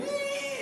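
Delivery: high-pitched little alarm squeal; radar squeak. Retold or imitated. Mark's radar going off, a high squeal. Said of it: "his radar's going".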